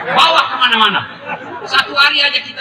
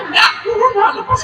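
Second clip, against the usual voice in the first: high, laughing let-go; playful mock plea; laughing mother; 9 semitones higher than usual. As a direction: shoo the baby off through laughter. Mother pulling baby off.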